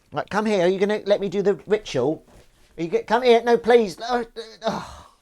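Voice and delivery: high cooing plea to come over; pleading kitten coax. Real, not acted. Coaxing kitten to do ritual.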